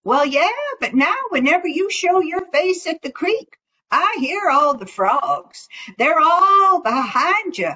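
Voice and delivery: lightly voiced rabbit, quick; high, mischievous telling; teasing rabbit voice.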